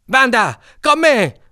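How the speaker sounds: loud, high call to charge; rallying yell. A narrator's reading. Cicin rallies the gang to dive after them, high yell. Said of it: "urlò Cicin".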